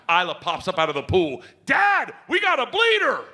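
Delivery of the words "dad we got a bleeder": high-pitched mock alarm yell; child calling out. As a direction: imitate a kid hollering for dad.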